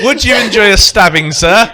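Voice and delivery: jokily formal server voice; mock waiter's offer. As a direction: offer it like an over-courteous waiter, laughing. Jokey polite offer of a stabbing.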